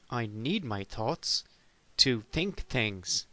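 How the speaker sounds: very slow, spelled-out words; slow insistence. Acted. Explaining to the teacher, slowly and deliberately. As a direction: drag each word out patiently. Defending his thoughts.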